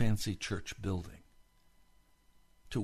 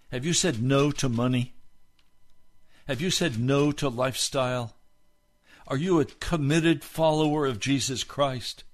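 rising, louder preacher's call, pressing each question; preaching at full force; 7 semitones higher, 6 dB louder than usual. Acted. Pastor challenging the online congregation, loud, high preaching. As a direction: hammer the questions home to the congregation.

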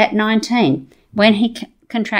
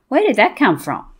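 usual speaking voice

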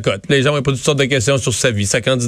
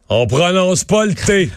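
advisor voice; 6 semitones higher, slower than usual. Retold or imitated. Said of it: "tes gens ... te disent".

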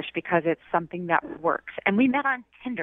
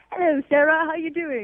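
higher-pitched mocking imitation of a British accent; 7 semitones higher than usual. Woman mimics her date's greeting to expose him, mock British accent. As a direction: throw his British greeting back at him in mockery. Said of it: "are you listening to that?".

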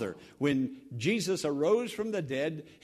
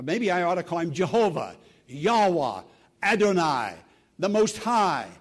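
emphatic preaching; 7 dB louder than usual. Acted. Preacher listing God's names.

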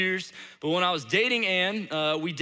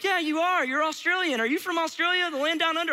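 put-on high, eager kid chorus; imitated class of kids; 10 semitones higher than usual. Whole class chiming in at the substitute, imitated kids. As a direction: pile on like a roomful of children, pitched up. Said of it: "the whole class was like".

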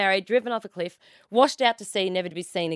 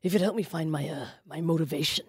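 Stone Cold Steve Austin; 3 semitones lower than usual. Retold or imitated. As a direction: play a wrestler new to acting, voice dropping. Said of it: "beautiful Steve".

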